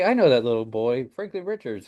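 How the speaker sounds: ordinary talk, nothing marked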